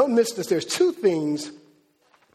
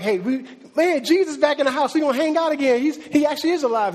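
higher, rising, giddy imitation; mock excited; 6 semitones higher than usual. Preacher voicing Mary's joy at Jesus returning, mock excited. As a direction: play Mary thrilled her friend is back.

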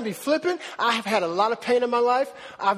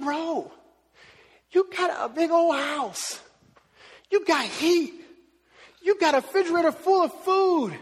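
higher-pitched, drawn-out listing in mock disbelief; exasperated dad; 5 semitones higher, slower than usual. Dad to his mopey son after a nap, exasperated. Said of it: "the most frustrating thing for me as a parent".

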